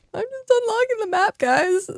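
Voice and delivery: high, giggly laughing repeat. Real, not acted. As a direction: squeak it out through laughter. Repeats line while laughing hard.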